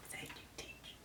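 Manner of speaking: whisper. Retold or imitated. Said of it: "I go".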